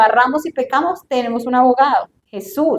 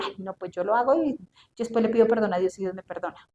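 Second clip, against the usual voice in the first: softer, fast, dismissive shrug of a voice; careless sinner; 4 semitones lower, 12 dB quieter, faster than usual. Retold or imitated. Preacher mimics a careless sinner, quick and soft. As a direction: toss it off like it costs nothing.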